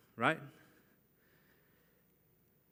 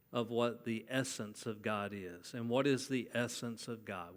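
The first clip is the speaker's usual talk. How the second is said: usual speaking voice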